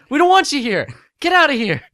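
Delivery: high loud yell to shoo it away; shouting. Acted. Rey yelling at the droid to get out.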